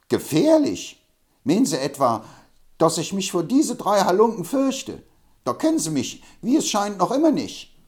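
put-on touchy old braggart, offended; indignant Hobble Frank. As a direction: bristle at being thought afraid.

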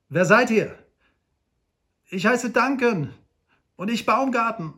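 calling out. Acted. Trapped men shout their names from the cave, loud call.

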